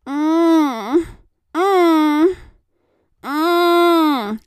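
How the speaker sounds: high, loud muffled yelling through a gag; muffled yells. A narrator's reading. Gagged captive yelling at his captor, muffled cries. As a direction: yell with your mouth stuffed shut. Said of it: "he yelled into the gag".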